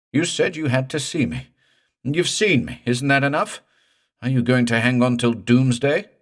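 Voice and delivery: high, loud desperate plea; begging in terror; frantic pleading. Acted. Morse begs the ghost to let go, high and frantic.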